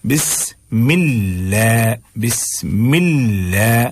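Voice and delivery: very slow "बिस्मिल्लाह", l light; thin lam. Shown as a demonstration. Teacher repeating the correct form, slow thin "बिस्मिल्लाह". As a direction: stretch "बिस्मिल्लाह" with fronted vowel. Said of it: "बारीक पढ़ना है".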